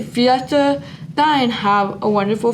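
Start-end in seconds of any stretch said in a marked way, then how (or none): 0.0-1.5 slow, higher "Fiesta Dine"; slow sign name. Shown as a demonstration. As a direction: draw it out as a sign-off. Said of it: "that's all for today".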